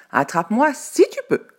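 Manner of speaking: very high, loud teasing taunt; cheeky dare. A narrator's reading. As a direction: taunt like a tiny runaway. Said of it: "pancake teasing and fleeing".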